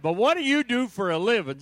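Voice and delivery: loud, high mock demand; loud retold question. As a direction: bark out the question like the asker.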